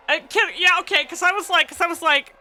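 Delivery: high, loud, excited outburst; worked up and talking fast. Real, not acted. Excited reaction to plot twist.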